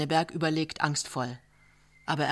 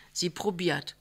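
ordinary delivery, nothing marked

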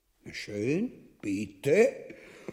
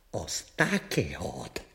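whisper; 9 semitones lower, 3 dB quieter, slower than usual. Acted. Hushed exchange about fur.

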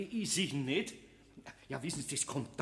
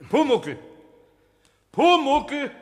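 loud, high call into the cellar; calling for a hidden goblin; 9 semitones higher, 10 dB louder, much slower than usual. Acted. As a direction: shout the name to summon him.

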